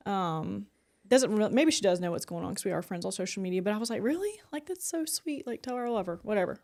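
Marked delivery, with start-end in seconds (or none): 4.0-6.6 high, gushing "Really?" and a sweet reply; retelling her own touched, gushing response; gushing quote. Retold or imitated. Telling her friend she loves her, high and gushing.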